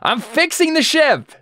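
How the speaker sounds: high, drawn-out distant holler; yelling back from below. Acted. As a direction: shout back up, acting defensive. Geralt yells back from below.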